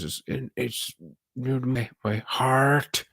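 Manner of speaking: mock American accent, stretching out my heart very slowly; hammed-up American accent, lingering on heart. Streamer mimicking the actor's odd phrasing, exaggerated American accent. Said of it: "he says with a very American accent".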